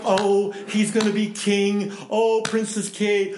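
slow, swooning gush over the royals; mock gushing excitement. Pastor mimicking fans gushing about the future king, slow and swooning. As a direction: gush like a starstruck fan. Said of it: "But we're all going".

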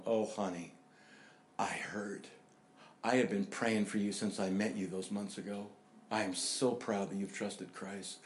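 softly retold loving congratulations; tender aunt. Voicing aunt's tender phone call.

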